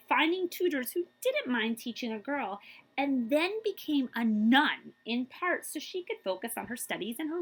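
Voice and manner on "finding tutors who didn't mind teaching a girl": usual speaking voice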